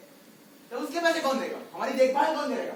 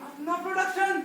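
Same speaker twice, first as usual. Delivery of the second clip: building, swelling daydream of riches, rising to loud peaks; dreamy boast; 4 semitones higher, 7 dB louder than usual. Acted. Circus man boasting to friends, swelling with excitement. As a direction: dream big and let it grow. Dreaming aloud of fame and wealth.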